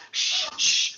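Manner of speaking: whispered shh to calm her; drunk shushing. Retold or imitated. Drunk husband hushing his angry wife, whispered. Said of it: "and I'm like".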